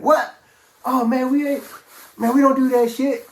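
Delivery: high, loud mock outrage; appalled protest. Inmates objecting to rocking up the coke, appalled. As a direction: imitate buddies flatly refusing, scandalized. Buddies appalled by his plan.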